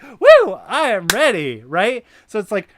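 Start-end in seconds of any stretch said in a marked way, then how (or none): 0.0-1.6 high, loud whoop of readiness; excited whoop. Pretend. Standing up fired with excitement, loud whooping cry. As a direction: leap up cheering. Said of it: "You just wanna stand up and be like".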